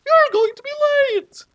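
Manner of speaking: high falsetto mother; mom voice. A narrator's reading. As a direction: squeak it like a cartoon mother.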